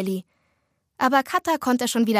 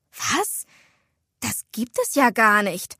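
dumbfounded. A narrator's reading. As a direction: react with stunned disbelief. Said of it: "Nelly war wirklich baff". (verblüfft und ungläubig)

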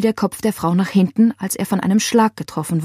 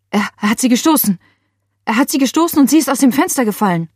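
fast, higher, louder stammering rush; panicked; 5 semitones higher, 3 dB louder, faster than usual. A narrator's reading. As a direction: blurt it out in panic. Panicked call reporting a murder.

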